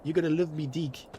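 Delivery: a joking Kiwi voice that can't say deck; mock Kiwi accent. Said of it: "I couldn't pronounce the word deck".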